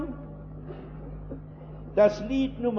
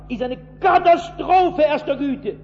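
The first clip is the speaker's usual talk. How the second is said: higher pulpit warning; stern preaching; 4 semitones higher than usual. Acted. Preacher warning fathers who cannot pray, raised and stern. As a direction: hammer the warning home.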